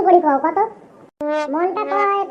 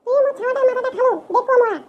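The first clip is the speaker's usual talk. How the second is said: wife voice; 5 semitones higher than usual. Acted.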